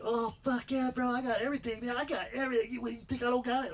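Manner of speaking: loud defensive gangsta-bro voice; cocky bro surgeon. Retold or imitated. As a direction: brag like a punk kid doctor. Cocky young surgeon boasting.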